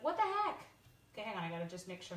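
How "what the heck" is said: high-pitched voicing of baffled viewers; put-on indignant squeal; mock indignation. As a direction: imitate everyone reacting in disbelief. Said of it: "Everyone else is like".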